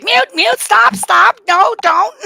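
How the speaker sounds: very high, loud frantic yelling; panicked shouting. Retold or imitated. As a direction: frantically order it to stop. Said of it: "I was like".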